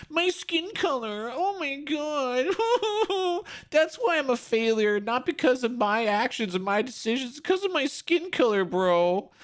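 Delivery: sarcastic high-pitched imitation; mocking whiny voice. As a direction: parody a self-pitying protester, high and whiny. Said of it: "mocking the protester".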